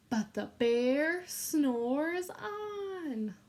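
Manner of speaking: very slow, drawn-out chant; slow sing-song refrain for children to repeat. Shown as a demonstration. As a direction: stretch every word so the kids can join in. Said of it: "this is your turn".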